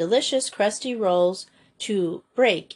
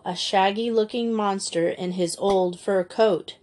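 ordinary delivery, nothing marked

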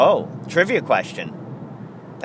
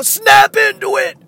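much higher, loud wrestler yell; Macho Man catchphrase; 7 dB louder than usual. Retold or imitated. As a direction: belt it out like the Slim Jim ad. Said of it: "He used to go".

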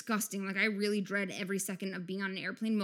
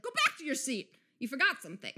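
imitating an exasperated call, higher pitched; mock near-yell; 4 semitones higher than usual. Passenger to a barefoot woman walking to the bathroom, mock yell. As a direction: call it out as if nearly yelling. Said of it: "I almost yelled at her".

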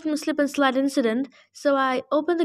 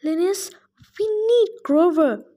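pleading nymph; 4 semitones higher than usual. Acted. Nymph begging the satyr to help find Grover, pleading. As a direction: beg him urgently.

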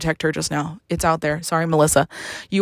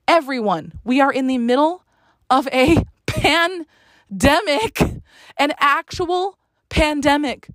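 heated rant; 6 semitones higher than usual. Retold or imitated. Caller lecturing the agency, in rising outrage. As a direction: let the indignation climb.

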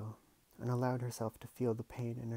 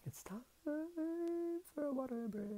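high silly voice; 4 dB quieter than usual. Real, not acted. Aside, announcing a break.

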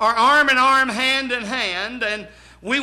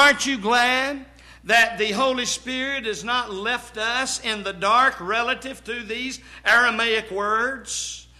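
ordinary talk, nothing marked

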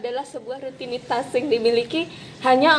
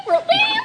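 mock censor beep.

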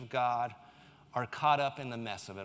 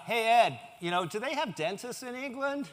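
higher-pitched mock questioner; teasing joker; 8 semitones higher than usual. Someone ribbing a British friend about England, mock teasing joker. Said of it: "all the jokes come out".

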